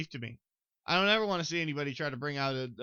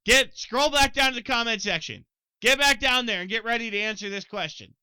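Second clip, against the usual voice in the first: higher, louder rallying call; hyped urging; 7 semitones higher, 7 dB louder than usual. Real, not acted. Host rallying viewers to answer in the comments, loud and pumped.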